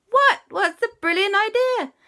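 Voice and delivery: very high, loud excited asking; a crowd of kids clamouring; eager question. Acted. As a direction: burst out wanting to know. Everyone asks eagerly.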